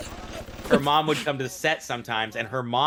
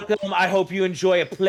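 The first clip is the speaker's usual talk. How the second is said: high, loud put-on settler rant; mock colonist rant; 7 semitones higher, 5 dB louder than usual. Acting a colonist with a musket.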